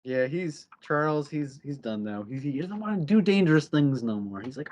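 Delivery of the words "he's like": ordinary delivery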